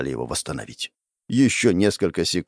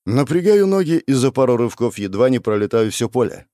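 usual speaking voice

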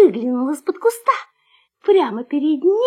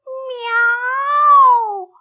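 high, long-drawn meow; loud cat meow; 9 semitones higher, 3 dB louder than usual. A narrator's reading. Someone by the kennel meows at the puppy, high, drawn out. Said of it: "кто-то громко".

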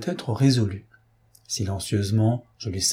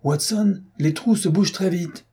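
much higher-pitched, urgent exclamation; crying out; 8 semitones higher than usual. A narrator's reading. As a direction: rouse a companion to act at once. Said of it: "cria Holmes en bondissant sur ses pieds". (exclamation pressante, voix nettement plus aiguë)

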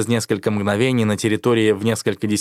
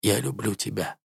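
faint whisper-soft; 7 dB quieter than usual. A narrator's reading. Dying dragon's last words.

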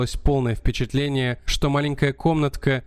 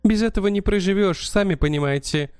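pleading countess; 4 semitones higher than usual. Acted. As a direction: protest it, pitched up and urgent. Countess protests innocence.

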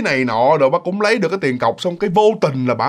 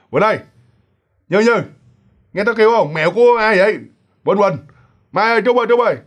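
calling down to sleeping roommates, higher-pitched; mock calling out; 5 semitones higher, faster than usual. Frightened roommate calls down from the loft to wake friends, calling out. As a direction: act out a call from upstairs, voice raised in pitch. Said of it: "kêu mà kêu lớn như vậy luôn".

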